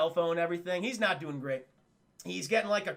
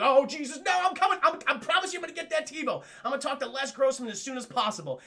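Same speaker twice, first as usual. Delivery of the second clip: high, loud, urgent promising; hammed-up desperate reassurance; mock panicked agent; 6 semitones higher, 3 dB louder than usual. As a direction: plead in a flustered rush of promises.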